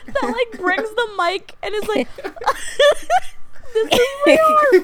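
high, breaking up with laughter; trying to talk through helpless giggles; laughing while talking. Real, not acted. On the podcast, cracking up over the preacher, laughing. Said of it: "What are you laughing about?".

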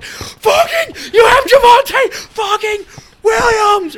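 high, loud, slow cry-shout of despair; fan's agonized shout at the TV; anguished yell. Retold or imitated. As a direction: wail it like your team just threw away a touchdown. Fan raging at fumble.

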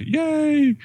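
very high, squeaky little hooray; hammed-up high-pitched celebration; mock cheer. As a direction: cheer like a delighted kid, playfully. Mock cheer in alternating list.

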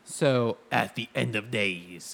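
slow trailer narrator voice; movie trailer voice. Acted. Reading movie description dramatically.

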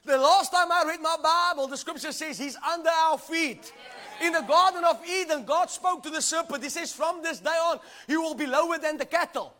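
preacher building the crowd; preaching at full force. Real, not acted. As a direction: keep the fire up.

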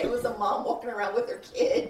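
ordinary delivery

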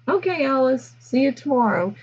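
high, singsong dismissal; breezy brush-off. Retold or imitated. Teachers brushing off angry Alice at lunch, high and singsong. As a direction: wave her off lightly.

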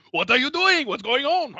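high, flustered squeak; panicked French driver. Retold or imitated. Podcaster mocking the French driver, high and flustered. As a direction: fluster like a startled smoker. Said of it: "he's like".

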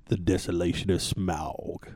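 deep, slow, softened trailer announcer; "Smaug" stretched out as smowg in a low announcer voice; radio trailer voice. Retold or imitated. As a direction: intone it low and drawn out like a film ad. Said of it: "and it said".

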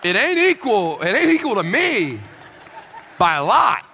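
emphatic loud declaration. Acted. Pastor insisting to the congregation, loud and emphatic. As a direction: hammer home that it ain't equal.

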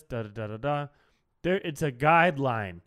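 ordinary talk, nothing marked

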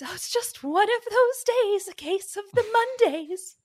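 high, breezy damsel, brushing it off; coy damsel voice. Retold or imitated. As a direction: play the flirty damsel, light and high. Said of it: "and says".

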